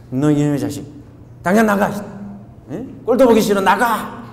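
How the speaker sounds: angry parent yell. Retold or imitated. 부모가 말 안 듣는 자식에게 나가라고 하는 장면, 점점 커지는 고함. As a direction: chase the brat out, voice rising.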